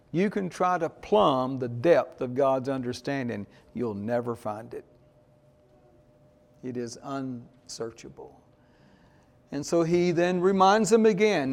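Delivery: normal talking voice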